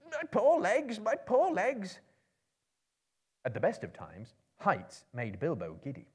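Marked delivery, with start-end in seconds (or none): 0.1-2.0 very high pained wail; groaning. A narrator's reading. Dory groans while Bilbo hangs from his legs, high pained wail. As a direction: cry out as your legs are pulled. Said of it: "Dory groaned".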